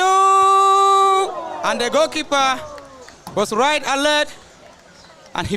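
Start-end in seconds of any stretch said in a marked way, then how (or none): 0.0-4.3 higher, louder, drawn-out slow commentator call; excited call. Real, not acted. Commentator calling the keeper's save to the crowd, long excited call. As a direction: stretch it out like a big save is coming. Live football commentary, goalkeeper action.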